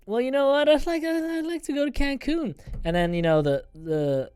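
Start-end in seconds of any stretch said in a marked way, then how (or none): 0.1-2.5 high, drifting and woozy; dreamy drugged voice. Retold or imitated. Patient answering the nurses while drugged, woozy and high. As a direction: float off as the drugs hit. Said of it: "I'm like".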